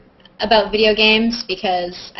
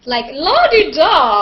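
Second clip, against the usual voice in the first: higher, louder and slow, a snooty sing-song; mock posh la-di-da; 6 semitones higher, 6 dB louder, slower than usual. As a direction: drawl it out like a stuffy aristocrat. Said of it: "seems a little highfalutin".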